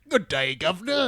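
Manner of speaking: put-on loud, hammy posh British accent; fake British accent. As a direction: ham up a stiff English gentleman accent. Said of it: "be like".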